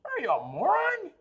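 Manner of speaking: high, half-crying laughing question; incredulous cry. Real, not acted. Laughing at his friend's money logic, high incredulous cry. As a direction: squeal it in amused disbelief. Mocking friend, repeats louder.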